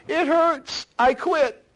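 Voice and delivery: high, loud mock whine of a quitter; whiny quitter. Preacher mocks a quitter giving up in pain, high whiny voice. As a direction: squeak out a pitiful give-up. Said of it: "if he would quit and say".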